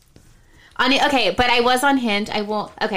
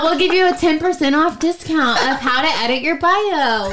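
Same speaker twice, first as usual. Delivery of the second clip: infomercial; 6 semitones higher, 4 dB louder than usual. Pretend. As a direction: play the TV salesman, laughing. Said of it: "I gotta do commercial".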